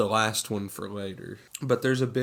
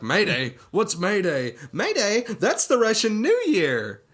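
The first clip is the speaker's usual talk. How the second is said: sassy, flamboyant impression, high and loud; Johnny Jacobs; 9 semitones higher, 6 dB louder than usual. Imitating the sassy office guy from the film, flamboyant. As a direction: climb higher with each excited question, camping it up. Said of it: "the sassy, flamboyant guy in the office".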